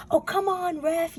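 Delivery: high-pitched heckle at normal volume; half-hearted yell from the stands; mock sports-fan yell. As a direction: pretend to yell at the referee. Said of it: "like, yelling".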